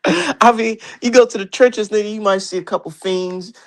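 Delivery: high, mocking disbelief; mocking rant. Real, not acted.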